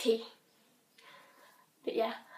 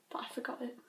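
whisper; 11 dB quieter, faster than usual. Real, not acted. Sheepish admission.

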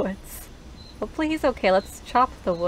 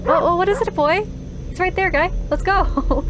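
calling a lost pet; 8 semitones higher than usual. Real, not acted. Dog staring into the woods.

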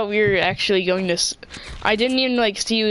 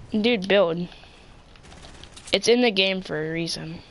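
normal talking voice

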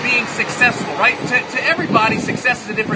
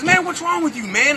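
high, shocked outburst; what on earth did you do; pitched-up disbelief at the guru; indignant kid; 6 semitones higher than usual. Retold or imitated.

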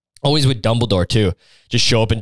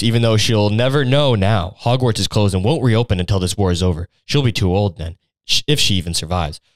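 usual speaking voice

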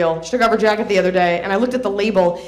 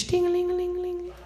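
high tinkly ding-a-ling chime sound, much higher; chime imitation; 10 semitones higher, 8 dB quieter than usual. Comic mimicking office wind chimes, high tinkly chimes.

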